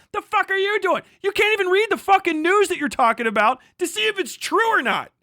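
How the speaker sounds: exasperated shouting at the reporters; angry rant. Real, not acted. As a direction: tear into them, really yelling it. Ranting at reporters he calls idiots.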